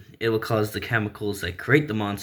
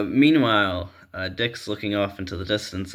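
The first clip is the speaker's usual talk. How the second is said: normal talking voice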